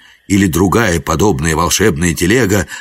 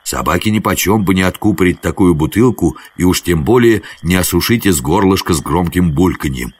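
ordinary delivery, nothing marked

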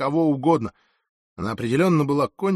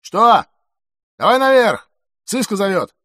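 loud, high-pitched calls; shouting between floors; 7 semitones higher, 8 dB louder than usual. A narrator's reading. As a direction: holler up and down the stairwell. Перекличка между этажами. (громкая перекличка)

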